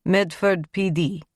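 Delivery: slow, lightly Russian cop; a hint of a Russian detective; Russian accent. A narrator's reading. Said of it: "with what sounded like a Russian accent".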